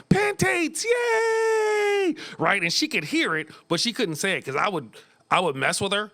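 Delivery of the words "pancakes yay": put-on high squeaky toddler cheering pantakes; little daughter shouting for pancakes. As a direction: squeal it like a delighted three-year-old. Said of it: "problems with her C's and her T's".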